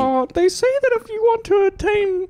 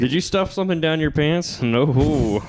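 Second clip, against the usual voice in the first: deep joke voice. Pretend. Improvised character voice bit.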